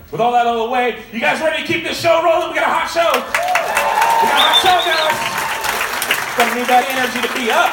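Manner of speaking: a comedy club host firing up the audience; hype shout. Real, not acted. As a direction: pump the crowd up like a club MC. Host hyping the crowd.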